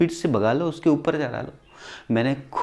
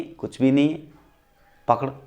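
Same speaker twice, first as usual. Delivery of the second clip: ordinary talk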